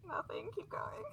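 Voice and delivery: hushed aside, breath only; whisper. Real, not acted. Player hushes partner to keep reading, whispered. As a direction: whisper it so the scene keeps going.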